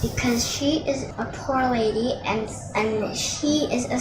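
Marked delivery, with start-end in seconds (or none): none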